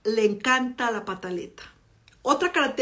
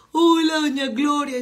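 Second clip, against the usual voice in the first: crying friend; 4 semitones higher, 5 dB louder, slower than usual. Retold or imitated. Crying friend greets mother again.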